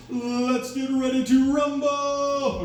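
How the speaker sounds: slow, high, drawn-out ring announcer call; boxing announcer. Retold or imitated. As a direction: stretch it like a fight announcer. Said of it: "his famous catchphrase is".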